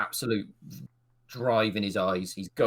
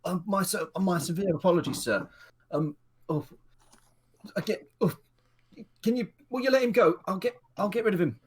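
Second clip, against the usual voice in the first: much higher, faster put-on voice apologizing; flustered guard; 9 semitones higher than usual. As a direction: stammer apologies in a panic. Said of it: "And he's like".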